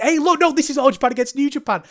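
high, loud burst of realisation; excited commentators. Retold or imitated. Commentary team suddenly spots the match-up, excited. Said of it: "the commentary realised it".

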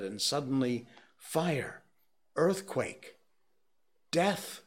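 rising panic. A narrator's reading.

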